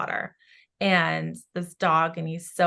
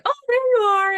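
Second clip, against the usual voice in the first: much higher and louder, delighted; overjoyed cry to a long-lost dog; delighted greeting; 8 dB louder, faster than usual. Retold or imitated. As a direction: greet the dog with joy, high and loud. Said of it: "he was like".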